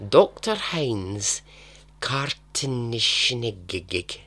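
slow, heavy mock German accent on the name. YouTuber stumbling over a German name, mock German accent. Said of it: "I don't know why I've developed this accent".

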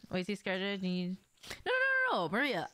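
ordinary talk

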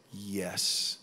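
low, drawn-out voice of the Lord; solemn divine assent. Retold or imitated. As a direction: give a slow, deep "yes".

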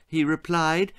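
usual speaking voice

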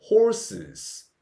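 "horses" with its -es ending sounded; plural pronunciation demo. Shown as a demonstration. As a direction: model "horses" clearly as two syllables. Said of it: "이렇게 발음합니다". (복수형 어미를 살린 horses 발음 시범)

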